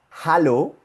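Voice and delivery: "hallo" with an audible H; sounded H. Shown as a demonstration. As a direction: model "hallo" in German, breathed H first. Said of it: "H con A".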